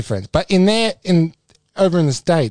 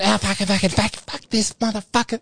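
higher, mock-fed-up rant; angry online crowd; 7 semitones higher, 3 dB quieter than usual. Imitating people ranting on social apps, higher mock-angry swearing. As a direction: sneer the swearing like an online mob. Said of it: "everyone was going".

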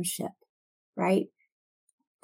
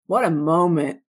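louder awed exclamation; mock amazement; 7 dB louder than usual. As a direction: marvel at it as Peter. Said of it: "he's like".

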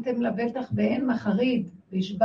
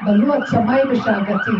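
loud, forceful declaiming; full-volume burst of quotation; roared verse; 11 dB louder than usual. Acted. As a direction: roar out the scripture line. Quotes verse about roaring, very loud.

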